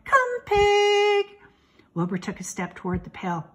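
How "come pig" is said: high, loud sing-out farm call; long, ringing livestock call; calling a pig. A narrator's reading. Farmer calls pig again.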